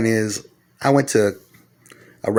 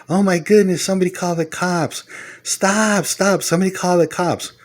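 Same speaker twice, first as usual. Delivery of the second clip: much higher, shrill-pitched mock panic; panicked wife; 10 semitones higher than usual. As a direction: play the frightened wife crying for the cops. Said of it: "the wife turns around and goes".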